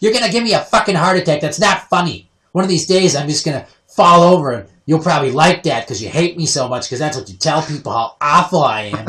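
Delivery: high, loud maternal scolding; imitating an aggrieved mother yelling; mock outraged rant. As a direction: rattle off a hammed-up guilt trip. Imitates mother's rant after scare.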